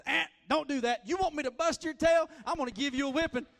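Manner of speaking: high, loud threatening scold; mother yelling at misbehaving kids; scolding mom. Retold or imitated. Wife scolding kids all day, loud threatening yell. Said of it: "all she said is".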